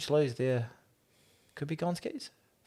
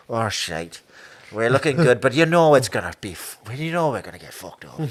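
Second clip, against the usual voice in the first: put-on lightly done pessimistic Irish accent; a hint of a gloomy Irish fan; 4 dB louder than usual. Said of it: "Irish accent's getting worse".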